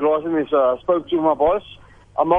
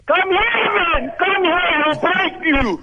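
barbarian; 8 semitones higher, slower than usual. Acted. Threatening the caller.

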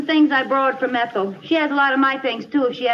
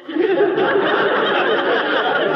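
a high, surprised burst of laughter; incredulous laugh; 9 semitones higher than usual. Acted. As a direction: laugh in disbelief. Laughs at being trusted.

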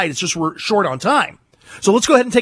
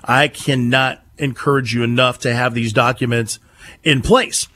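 lower, measured sponsor read; 7 semitones lower than usual. Acted. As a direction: sell the law firm calmly, like a radio spot. Reads a sponsor ad.

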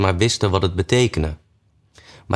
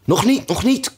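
much higher, hurried rabbit voice; hasty rabbit. A narrator's reading. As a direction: cut in quickly, high and fussy. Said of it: "kwam het konijn haastig tussen beiden".

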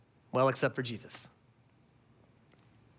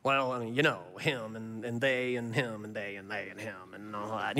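low, soft grumbling under the breath; mock mutter; 10 semitones lower, 8 dB quieter than usual. Pharisees grumbling about Jesus, a soft mock mutter. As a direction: mumble it like a sulky gossip. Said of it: "They mutter their accusation".

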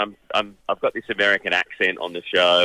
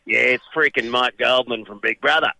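imitated grumpy old American cameraman, pitched up; 4 semitones higher than usual. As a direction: grumble it like a fed-up old crewman. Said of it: "this grumpy old cameraman".

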